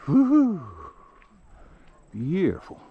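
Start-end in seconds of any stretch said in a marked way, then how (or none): 0.1-0.6 much higher, loud whoop; joyful whoop. Real, not acted. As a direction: whoop out to the hills. Cheering at the view.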